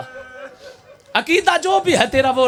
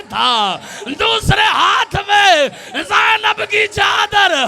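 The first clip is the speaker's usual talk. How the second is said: preaching at full force; 8 semitones higher, slower than usual. Acted. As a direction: stretch every word in grief. Final climactic lament. (बहुत धीमी, ऊँची, ज़ोरदार मातमी पुकार)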